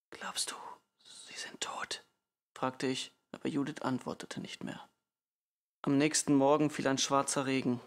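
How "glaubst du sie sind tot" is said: very soft, slow whisper; whispered question. A narrator's reading. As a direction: whisper it haltingly into the dark. Fearful whispered question at night. (zaghaft geflüsterte Frage)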